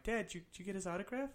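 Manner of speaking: put-on quick, high, soft kid's question; eager seven-year-old. Seven-year-old asking dad about an autograph, lightly eager. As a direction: lightly voice a child asking fast. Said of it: "I'm, like, seven".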